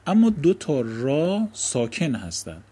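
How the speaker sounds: usual speaking voice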